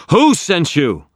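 high, loud shout; hammering demand. A narrator's reading. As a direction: hammer the question at it. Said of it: "hammering on the spell".

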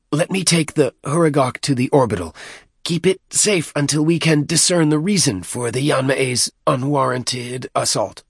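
high-pitched deacon's voice; petitioning deacon. A narrator's reading. Deacon asking leave to take the Hurugok away, high-pitched.

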